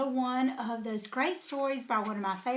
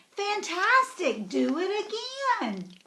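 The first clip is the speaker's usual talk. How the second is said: slow, higher mock cheer; mild shout; 7 semitones higher, slower than usual. Elizabeth egging the dragon on, mock cheer. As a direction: egg him on, cheering. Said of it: "Elizabeth shouted".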